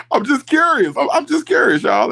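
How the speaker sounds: high, loud mock innocence. Real, not acted. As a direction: playfully insist she's just curious. Laughing, exaggerated innocence.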